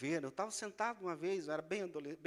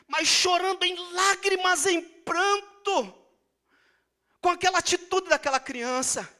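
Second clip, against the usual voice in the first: loud, high climax of a sermon; preaching at full force; 10 semitones higher, 19 dB louder than usual. Acted. Preacher telling the congregation the miracle, shouting. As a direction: build the story to a shouted hallelujah.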